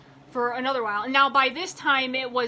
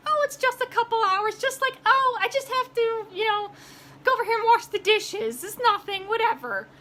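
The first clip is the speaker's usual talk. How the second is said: high, airy, dismissive imitation; mocking the agent; 10 semitones higher than usual. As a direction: make light of it in a mock-breezy high voice. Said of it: "you're just thinking is like".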